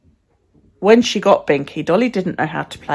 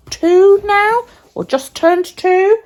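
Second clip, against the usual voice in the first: excited; 13 semitones higher, 4 dB louder, much slower than usual. Real, not acted.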